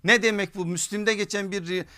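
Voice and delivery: ordinary delivery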